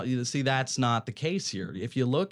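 ordinary talk

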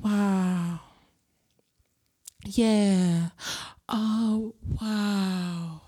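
low, soft, drawn-out Willow wow. Retold or imitated. Imitating Willow reacting to the story, low and hushed. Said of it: "in my Willow voice".

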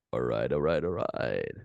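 low, drawled Texan alright; deep, lazy Texas drawl; Texan accent. Pretend. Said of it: "Einen texanischen". (tiefer texanischer Akzent)

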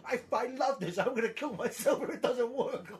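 hammed-up angry passion; mock passionate rage. Imitating the host's angry passion about things he loves. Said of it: "very passionate and angry".

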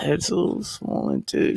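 unvoiced whisper. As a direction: whisper it sheepishly. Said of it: "I was like".

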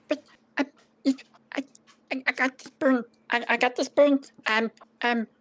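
high, stammering little goblin; timid goblin caught out; flustered goblin. Acted. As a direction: clutch the spoon and stammer. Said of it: "he looks at you and says".

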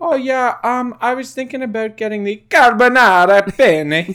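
ordinary ordering that erupts into loud Italian; Italian-word emphasis. Acted. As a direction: blow up the dish name in hammy Italian. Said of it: "I put emphasis on it".

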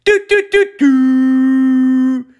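high, loud electronic beep imitation; three short beeps and a long one; breathalyzer beeps. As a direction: imitate a testing device beeping.

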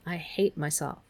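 soft, mildly shaky confession. A narrator's reading. Meg confides in Calvin, softly and a little shakily. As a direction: let it fall away softly, a touch unsteady. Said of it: "Meg said shakily".

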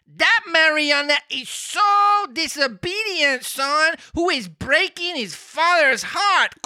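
high, slow, drawn-out cricket voice; talking cricket voice. Acted. Reading Pinocchio aloud, the cricket speaks in a high voice. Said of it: "that voice is too good".

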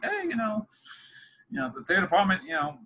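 mock sarcasm, pitched high; lightly sarcastic, high-pitched retelling. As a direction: imitate professors talking snidely about their department. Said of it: "say that in a sense of a very sarcastic way".

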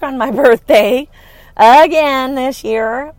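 a rueful laugh at working again. Real, not acted. Laughing to listeners about working on her birthday.

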